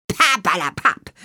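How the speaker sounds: croaky scoffing nonsense word; dismissive croak. A narrator's reading. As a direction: wave it away with a croak. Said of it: "krächzte Hexubine".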